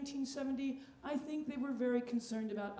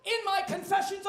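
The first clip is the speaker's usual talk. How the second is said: high, loud, grandiose declaiming; hammed-up Shakespearean actor; 8 semitones higher, 7 dB louder than usual. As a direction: proclaim it like a ham on stage. Mock Shakespeare speech, partly sung.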